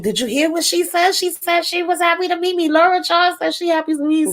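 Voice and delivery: high, fast starstruck gushing; goofy geeky excitement. Retold or imitated. Friend gushing to Leroy over meeting Laura Charles, high and fast. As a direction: gush like a starstruck fanboy. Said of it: "acting all goofy and geeky over her".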